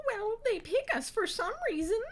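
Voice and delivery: very high, loud falsetto; proud character voice. A narrator's reading. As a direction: assert it in a piercing put-on voice. Shiraz answers in her put-on voice.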